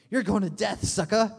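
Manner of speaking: hammed-up doom hurled at a sinner; mock condemnation. Acting how not to warn friends.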